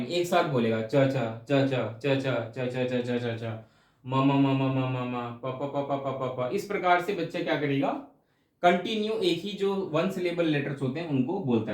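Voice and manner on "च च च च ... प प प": slow chain of च च, म म म, प प प; repeated baby babble. Shown as a demonstration. Teacher imitating a baby repeating one syllable. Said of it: "च च को रिपीट करेगा".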